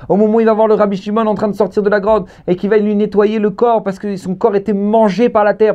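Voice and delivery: high, insistent sermon storytelling; fervent high-pitched preacher; impassioned preaching. Real, not acted. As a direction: declaim it high, driving the story on. Preaching at full force.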